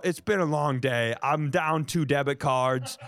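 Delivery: normal talking voice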